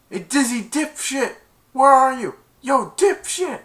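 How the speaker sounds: high, loud taunting holler; calling out. Retold or imitated. Barkley hunting for Dizzy, loud mocking call. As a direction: call across a crowd for someone.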